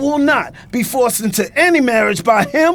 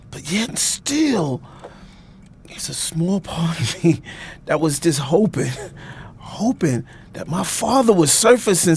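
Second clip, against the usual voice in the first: hurt; 7 semitones lower, 5 dB quieter than usual. Acted. Hurt confession.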